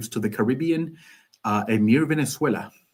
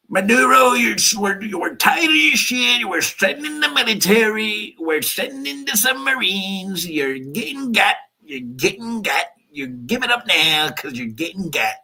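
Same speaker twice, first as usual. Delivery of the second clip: hammed-up New York threat, stretched and pitched up; Donald Trump; 10 semitones higher than usual. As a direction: caricature a New York tough talker. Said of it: "That was back when I was in New York".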